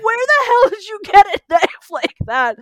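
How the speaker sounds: high, loud, slow panicked shriek; terrified. Retold or imitated. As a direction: squeal in horror at the machete. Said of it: "And he's like".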